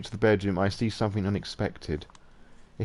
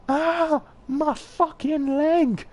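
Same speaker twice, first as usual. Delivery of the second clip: pained scream; much slower than usual. Acted. Man kicked in the leg screaming in pain. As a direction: shriek as the kick lands.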